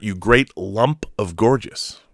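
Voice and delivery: ordinary delivery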